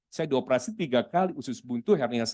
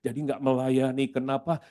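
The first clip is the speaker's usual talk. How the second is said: ordinary talk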